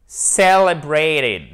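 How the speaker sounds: Celebrated very slow, first syllable stressed, full diphthong in -brat-; Celebrated, stretched out, with the long ay sound; slow model pronunciation. Shown as a demonstration. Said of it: "il faut bien prononcer la diphtongue".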